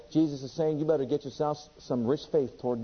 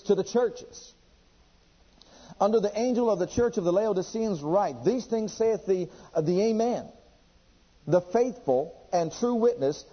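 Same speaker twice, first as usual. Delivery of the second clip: raised scripture reading; 5 semitones higher than usual. Acted. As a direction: proclaim the verses to the congregation. Reads scripture aloud, raised.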